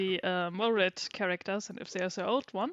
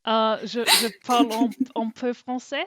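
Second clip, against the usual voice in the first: speaking French; 3 semitones higher, 10 dB louder than usual. Shown as a demonstration. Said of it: "this is everything I can do".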